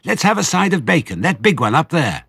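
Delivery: high and loud with glee; almost squeal for the bacon; excited badger. A narrator's reading. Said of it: "dancing with excitement".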